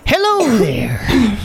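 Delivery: high, slow, sing-song cheery greeting; all cheery, eyes wide; customer service voice. Acted. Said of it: "customer service voice".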